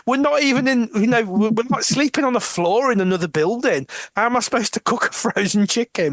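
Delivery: high, exasperated prisoner protest. Retold or imitated. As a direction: argue that cooking is impossible. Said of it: "And she's like".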